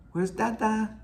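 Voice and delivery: very high, held notes on "Where's Dada"; sing-song. Shown as a demonstration. Teacher showing inflection in baby talk, sing-song. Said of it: "when your voice goes up".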